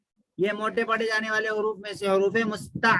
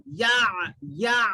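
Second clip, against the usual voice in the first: ordinary talk, nothing marked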